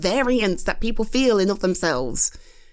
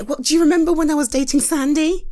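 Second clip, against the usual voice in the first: usual speaking voice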